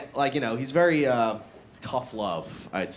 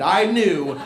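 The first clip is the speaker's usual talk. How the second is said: usual speaking voice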